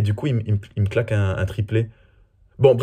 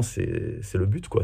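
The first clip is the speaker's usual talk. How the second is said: normal talking voice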